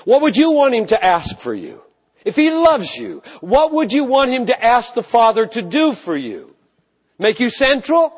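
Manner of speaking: high, loud, urgent questions to the church; fervent preaching. Acted. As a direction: push the question at them, rising. Preacher pressing the congregation.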